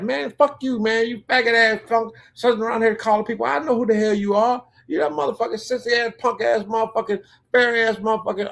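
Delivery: high-pitched, rapid-fire retold cussing out; angry tirade. Cussing out the caller on the phone, high heated tirade. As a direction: rattle off insults in a worked-up high voice.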